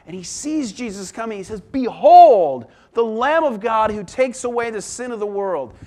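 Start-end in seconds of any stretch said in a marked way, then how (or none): 1.6-5.8 a big ringing Behold, then carry it loud and high; booming prophetic announcement; loud proclamation. Acted. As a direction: herald the Lamb to the crowd. Preacher voices the Baptist again.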